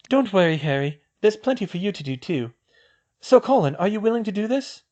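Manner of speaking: put-on girl's voice. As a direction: voice the girl, a clear step up in pitch. Female character voiced high.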